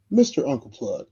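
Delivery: Mister stretched and punched, the rest light; stress on mister. Retold or imitated. Chauffeur greeting the guest, Mister hit hard. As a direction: lean hard on Mister in Mister Uncle Plug. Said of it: "they put the emphasis on the mister".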